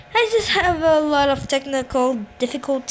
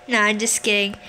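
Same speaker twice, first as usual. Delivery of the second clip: normal talking voice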